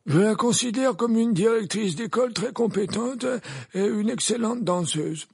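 high, measured, unruffled tone; even-voiced Dumbledore. A narrator's reading. Dumbledore vouching for the headmistress, evenly. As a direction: keep it even and composed, high in pitch. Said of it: "d'une voix égale".

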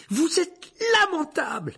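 very high, loud, drawn-out shout; outraged cry. A narrator's reading. Boy insults his teacher, outraged cry. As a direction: hurl the insult at full voice. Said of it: "s'écria alors Harry".

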